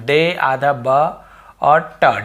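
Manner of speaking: डे आधा ब और ट, slow, piece by piece; sounded out slowly. Shown as a demonstration. Teacher breaking down the misreading, डे आधा ब और ट slowly. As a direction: spell out the sounds in डे आधा ब और ट with pauses. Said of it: "ऐसे पढ़ते हम".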